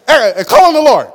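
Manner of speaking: high, loud mock command, call on the Lord; hammed-up lawgiver ordering the wounded. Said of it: "Do we go as a lawgiver?".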